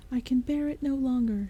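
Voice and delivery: hammed-up despairing exclamation; exclaiming. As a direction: burst out in despair. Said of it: "she exclaimed".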